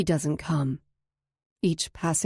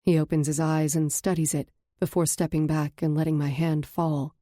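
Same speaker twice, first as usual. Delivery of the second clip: ordinary talk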